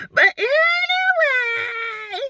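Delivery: high, slow, stretched-out shout of "anyway"; drawn-out anyway. Real, not acted. Host pulling her viewers back to topic, stretched shout. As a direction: yank everyone back on topic with a big long cry.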